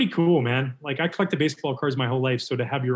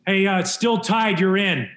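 raised, louder, higher call; calling through a door; 6 semitones higher, 5 dB louder than usual. Retold or imitated. Bullpen coach to player in the bathroom, calling out. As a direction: holler the news to someone inside.

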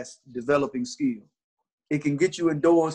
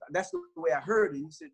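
usual speaking voice

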